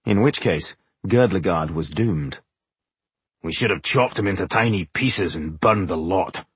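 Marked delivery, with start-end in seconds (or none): 3.4-6.5 low-key dwarf growl in a put-on voice. A narrator's reading. Dwarf complaining to his companions about their foe, growled. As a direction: grumble it as a disgruntled dwarf warrior. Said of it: "growled Boindil".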